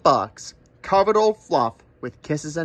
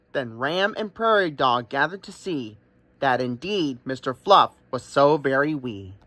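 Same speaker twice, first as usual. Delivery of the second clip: ordinary delivery, nothing marked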